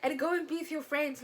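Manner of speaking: loud, high, hurt accusation; a girlfriend yelling at her boyfriend for leaving her alone; upset outburst. Retold or imitated. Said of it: "I was so upset with him".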